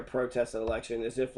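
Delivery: normal talking voice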